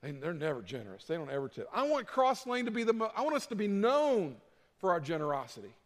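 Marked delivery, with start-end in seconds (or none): 0.0-1.7 mock scornful waiter. Preacher mimics an unimpressed waiter, quietly sneering. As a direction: sneer it lightly like a waiter who got stiffed. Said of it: "and they're gonna go".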